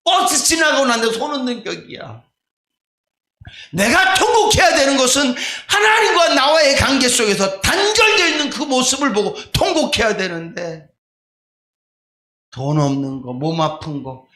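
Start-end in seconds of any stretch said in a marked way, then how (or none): 0.0-10.9 preaching at full force; fiery preaching. Acted. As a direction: shout the call to weep and pray. Exhorting on what to lament.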